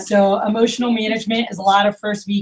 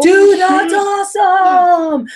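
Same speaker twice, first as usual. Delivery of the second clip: high, loud, slow sing-song gush; overexcited gushing; 10 semitones higher, 7 dB louder, much slower than usual. Shown as a demonstration. New manager overhyping a rep's first sale, sing-song gush. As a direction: overdo the praise like a new manager. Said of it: "What you don't wanna do, and I see new managers do".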